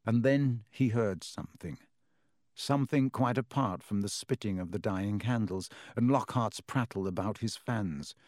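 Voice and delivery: usual speaking voice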